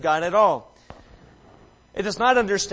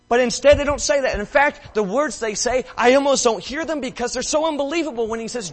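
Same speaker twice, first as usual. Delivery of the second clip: louder and higher, building with awe; rising preaching fervor; 6 semitones higher, 5 dB louder, faster than usual. Real, not acted. Preacher building to the release scene, rising fervor. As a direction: let the relief swell in your voice.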